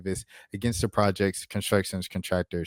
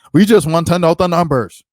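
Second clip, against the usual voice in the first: louder, higher exasperated plea; exasperated, pleading for the numbers; mock exasperated plea; 7 semitones higher, 10 dB louder than usual. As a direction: requesters pleading to see the numbers. Voicing the requesters.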